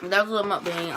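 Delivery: ordinary talk, nothing marked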